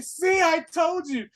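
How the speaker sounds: high, slow shouted gloat; mock gloating shout. Brother gloating to the Inquisitor, high slow shout.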